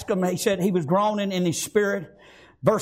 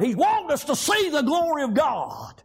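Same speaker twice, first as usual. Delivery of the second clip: raised, ringing burst of praise; preached shout; 6 semitones higher than usual. Acted.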